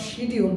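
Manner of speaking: schedule said as SHEE-dyul; wrong pronunciation. Shown as a demonstration. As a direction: mispronounce schedule with a long shee. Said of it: "not schedule". (schedule ভুলভাবে শিডিউল)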